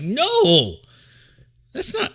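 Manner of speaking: loud, high mock-outraged "no"; indignant retort. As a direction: act out an affronted denial. Acts out own indignant denial.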